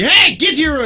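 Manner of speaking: high, loud mock parent order; yelling. Mom sends the toddler to her room, loud order. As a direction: bark it like a mom sending a kid away. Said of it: "she, like, yelled at her".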